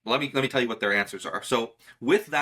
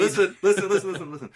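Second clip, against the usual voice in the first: loud, laughing listen listen; laughing interruption; 6 semitones higher, 3 dB louder, faster than usual. Real, not acted. A magician laughing as he cuts off his cohost's argument, louder and higher. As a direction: laugh while talking over him.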